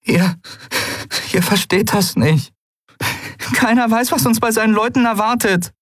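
high, halting, upset plea; tearful. A narrator's reading. Pilot begging her friends to understand, tearful. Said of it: "Tränen brannten in ihren Augen".

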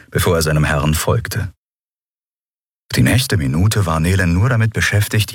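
usual speaking voice

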